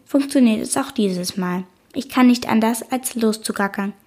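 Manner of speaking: usual speaking voice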